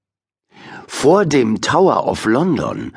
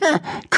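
1 cheeky rat laugh; 4 dB quieter than usual. Acted.

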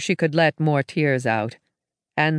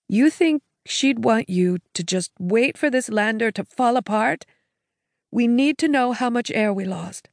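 a hint of a higher, urging sister voice; lightly voiced sister; 6 semitones higher than usual. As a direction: gently push him to act, slightly higher. Sister urges grieving Jake.

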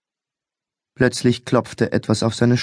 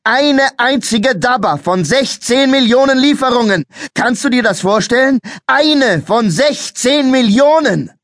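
much higher, louder furious bellow; 13 semitones higher, 5 dB louder than usual. A narrator's reading. Policeman bellowing at the boy about the odds, furious. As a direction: roar it out, slowing on the end. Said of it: "Der Polizist hörte auf zu brüllen".

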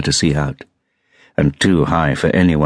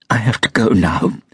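higher-pitched, lightly acted sob; sobbed; 6 semitones higher than usual. A narrator's reading. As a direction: say it high with a touch of tears. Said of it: "she sobbed".

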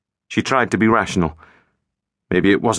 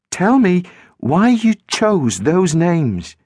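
slow, higher, careful request; careful probe; 9 semitones higher, much slower than usual. A narrator's reading. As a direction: coax it out gently. Girl probing carefully.

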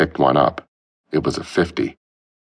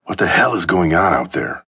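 demanding; 3 semitones higher than usual. A narrator's reading. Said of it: "he demanded".

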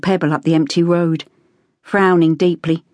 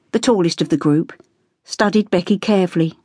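ordinary delivery

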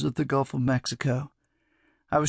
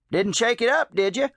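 put-on higher, teasing old man; 8 semitones higher than usual. A narrator's reading. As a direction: tease him with a grin.

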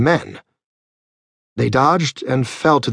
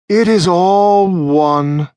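condescending scholar; 6 semitones higher, 5 dB louder, much slower than usual. Acted. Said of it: "he remarked".

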